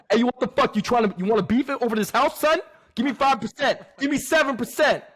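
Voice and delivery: high-pitched, combative mock New York voice; hammed-up New York tough talk; New York accent. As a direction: haggle like a pushy New York hustler. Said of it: "disrespected every New York accent".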